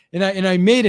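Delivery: ordinary talk, nothing marked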